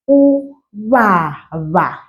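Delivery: uvava drawn out slowly, every syllable clear; slowed way down. Shown as a demonstration. Teacher slowing a word for beginners. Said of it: "I'm slowing down".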